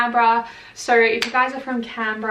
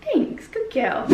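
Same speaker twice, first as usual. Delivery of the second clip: squeaky praising a dog; 6 semitones higher, slower than usual. Real, not acted. Praising the puppy.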